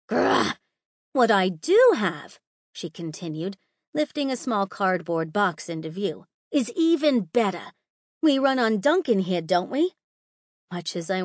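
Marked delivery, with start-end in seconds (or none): 0.1-0.6 frustrated growl. A narrator's reading. 1.1-2.4 teasing. A narrator's reading. Holly continues teasing. 6.5-9.9 playful teasing. A narrator's reading. Holly teasing with donut.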